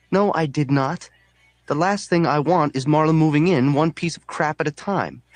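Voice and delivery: high, agitated protest; exasperated refusal. A narrator's reading. Narrator refusing Marla's junk in the house, high and exasperated. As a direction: dig your heels in, pitched well up.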